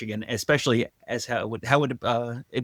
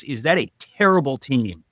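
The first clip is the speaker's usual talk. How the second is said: normal talking voice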